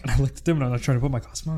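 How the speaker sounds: normal talking voice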